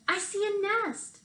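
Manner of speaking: put-on high, eager child; a child spotting something up a tree, lightly voiced; child pointing up at a nest, high and eager; child's voice. A narrator's reading. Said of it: "one of the children said".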